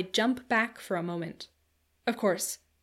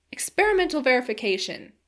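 higher, louder exclamation; astonished cry; 4 semitones higher, 7 dB louder than usual. A narrator's reading. Narrator interrupting the time traveler's claim of proof, crying out. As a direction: cry it out in disbelief. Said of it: "cried I".

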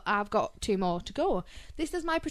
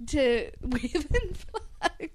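laughing; 6 semitones higher, much slower than usual. Real, not acted. Laughing in disbelief.

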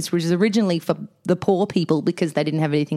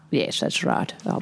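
whispering; 3 semitones lower, 6 dB quieter than usual. Real, not acted. Whispered aside.

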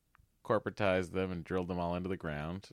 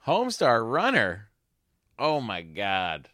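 excited; 3 semitones higher, 6 dB louder, slower than usual. Real, not acted. Nostalgic exclamation.

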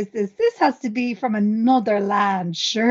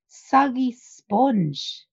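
slow, disgusted; much slower than usual. Real, not acted. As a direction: drag out soggy sponge slowly in disgust. Lingering disgust at sponge.

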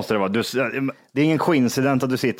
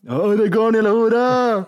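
higher, louder mocking heckler voice; rude heckler; 10 semitones higher, 4 dB louder than usual. Mocking a heckler at the Lord of the Rings film. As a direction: play an obnoxious guy yelling in the cinema. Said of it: "någon som står och bara".

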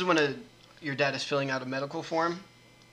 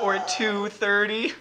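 slow, higher "two thirty" with a rise; slow repeat; 5 semitones higher, 5 dB louder, much slower than usual. Shown as a demonstration. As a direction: spell out the pun slowly. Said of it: "Tooth hurty".

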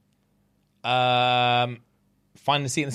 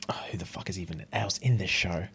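whisper; 9 semitones lower, 8 dB quieter than usual. Real, not acted. Muttered aside while stuck.

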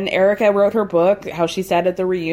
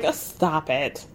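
low, slow, softer dismissal, imitated; exasperated eye-roll; 6 semitones lower, 7 dB quieter, slower than usual. Garcelle brushing off talk of Harry, exasperated. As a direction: wave it off with a weary eye-roll in the voice. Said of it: "roll her eyes and be like".

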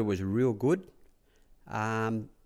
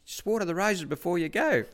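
high-pitched imitation of a trainer; raised-pitch impression of the boss; Bart; 8 semitones higher than usual. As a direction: insist on the chore in a put-on high voice. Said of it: "He goes".

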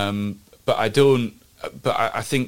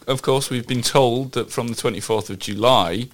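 normal talking voice

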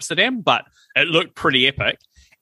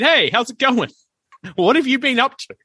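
retold breezy greeting; 10 semitones higher than usual. Retells cheerful greeting to wrong woman.

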